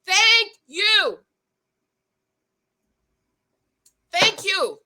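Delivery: high emphatic thank you, each one punched. Retold or imitated. Vlogger cheering the brother's point, emphatic. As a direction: drum home the agreement, thank you by thank you. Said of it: "And I was like".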